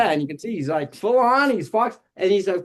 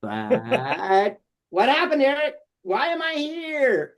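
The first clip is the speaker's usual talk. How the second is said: higher, slow, puzzled puppet asking its maker; the puppet wonders why it exists; Foxford puppet voice; 6 semitones higher, slower than usual. Acted. Foxford asks puppeteer Eric why he was made, in a high puzzled voice. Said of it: "And I was like".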